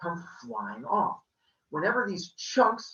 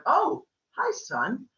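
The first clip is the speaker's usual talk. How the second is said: a much higher oh hi; high wary greeting; 9 semitones higher, much slower than usual. Acted. Father greets son warily.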